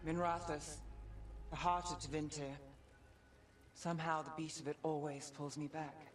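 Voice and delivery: brooding narration. Acted. Narrating arrival in the city.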